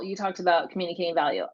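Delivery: ordinary talk, nothing marked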